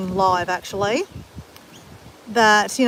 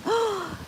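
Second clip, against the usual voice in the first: gasp; 10 semitones higher, 7 dB quieter than usual. Real, not acted. Sudden interesting thought.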